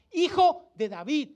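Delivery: slow, loud, high call; retold shouting. Preacher voicing the blind man's plea to Jesus, retold shouting. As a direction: cry it out like the blind man calling. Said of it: "este hombre más gritaba".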